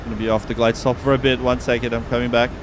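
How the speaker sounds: ordinary talk, nothing marked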